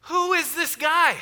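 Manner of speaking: high, loud cry of protest; a bit of mock horror from the crowd; mock outrage. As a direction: squeak out a mock horrified protest. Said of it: "you're like".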